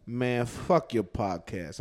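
low, slow, quiet grumble imitating him; retold sullen comeback. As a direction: mutter it like a wounded friend. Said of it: "He's like".

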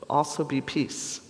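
ordinary delivery

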